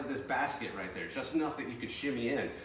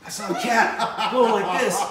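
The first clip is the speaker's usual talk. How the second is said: high, rattled voice getting louder; imitating a shaken friend; shaken retelling; 7 semitones higher, 6 dB louder than usual. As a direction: still spooked by what he saw. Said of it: "he's like".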